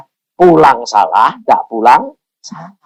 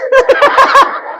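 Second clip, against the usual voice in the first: high burst of laughter. Real, not acted. Preacher laughing about still being young, high laugh.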